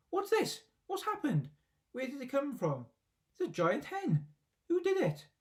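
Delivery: very high, frantic, worked-up cries. A narrator's reading. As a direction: shriek in a flap. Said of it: "he cried".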